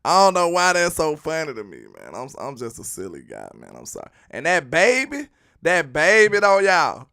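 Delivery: usual speaking voice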